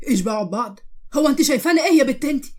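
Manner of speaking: offended indignant retort. Acted. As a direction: throw her words back at her, outraged. Brother offended by her words.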